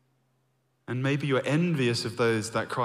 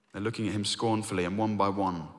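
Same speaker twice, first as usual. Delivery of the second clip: ordinary talk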